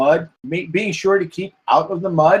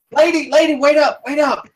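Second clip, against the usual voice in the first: calling after her; 9 semitones higher than usual. Acted. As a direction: call out to catch her. NPC calls after her.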